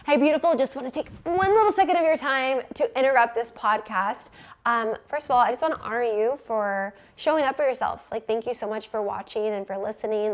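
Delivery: upbeat host interrupting her own podcast; ad break read. Acted. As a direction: switch into a friendly ad read.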